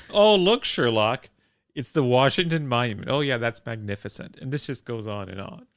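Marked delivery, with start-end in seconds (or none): 0.1-4.4 louder gushing tour guide pointing out sights; eager tour guide. Retold or imitated. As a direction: show off the monument like an overexcited host. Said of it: "the driver will say".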